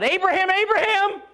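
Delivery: high, loud call from heaven; angel crying out. Retold or imitated. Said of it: "an angel of the Lord cries out".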